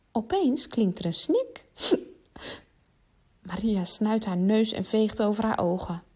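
ordinary delivery, nothing marked

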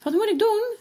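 high, loud panicked question; nervous cry. A narrator's reading. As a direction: cry out in fear, not knowing what to do. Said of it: "riep hij zenuwachtig". (zenuwachtig en luid roepend)

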